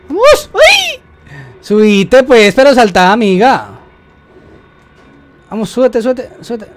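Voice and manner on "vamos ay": 2 sudden high squeal of alarm; 3 yelp it out as the jump goes wrong; 1 startled shriek. Real, not acted. 4 player yelping at his game partner, high startled shriek.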